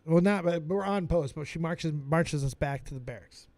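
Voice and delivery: ordinary talk, nothing marked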